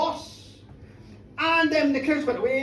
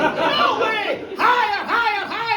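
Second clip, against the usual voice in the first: high, punchy demand for more; auctioneer's protest; 6 semitones higher, 3 dB louder than usual. Acted. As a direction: call for higher bids at volume. Old man rejects low bid.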